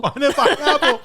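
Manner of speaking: high, laughing repeat of the word. Real, not acted. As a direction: blurt it out through laughter. Safety word joke, laughing.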